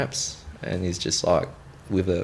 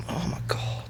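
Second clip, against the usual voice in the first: hushed, breath-only disbelief; appalled whisper; 6 dB quieter than usual. Retold or imitated. Soldier hears colleague's casual excuse, appalled whisper. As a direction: whisper it as if aghast.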